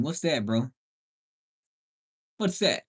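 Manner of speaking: normal talking voice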